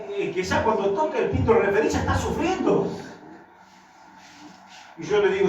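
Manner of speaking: ordinary talk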